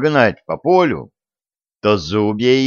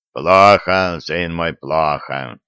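low vicar voice; 5 semitones lower, slower than usual. A narrator's reading. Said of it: "подтвердил викарий".

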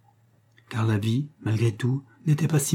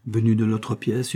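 plain reading voice

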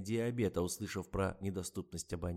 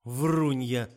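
loud sharp cry; accusing shout; 4 semitones higher, 11 dB louder than usual. A narrator's reading. Old woman shouts at the writer, loud and accusing. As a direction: hurl the accusation.

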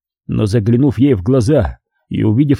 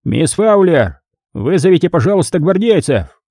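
high, polite cardinal voice; cardinal giving orders; 6 semitones higher than usual. Acted. Cardinal asking his secretary for guardsmen, politely, high-voiced. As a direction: summon help as the cardinal, pitched up.

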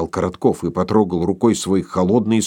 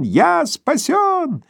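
higher, drawn-out joyful cry; ecstatic, slower and higher; much slower than usual. Acted. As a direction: stretch it out in rapture. Korotkov rejoicing. (восторженный протяжный возглас)

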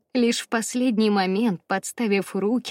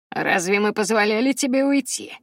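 plain reading voice

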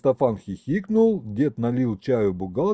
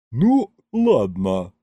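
slow, drawn-out concession; grudging, slowed-down acceptance; sighing, calming down; 3 dB louder, much slower than usual. A narrator's reading. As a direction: give in slowly with a sigh. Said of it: "вздохнула, успокаиваясь бухгалтер".

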